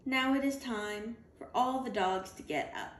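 usual speaking voice

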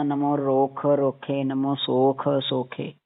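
ਰੋਖ and ਸੋਖ with aspirated kh fully released; slow "ro-kha ro-khe" for ਰੋਖ ਰੋਖੇ; full "kh" sound. Shown as a demonstration. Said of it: "ਖੱਖਾ ਪੂਰਾ".

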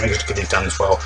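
normal talking voice

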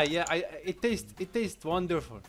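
ordinary talk

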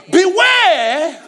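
laughing shout of warning; loud warning. Real, not acted.

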